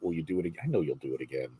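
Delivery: ordinary talk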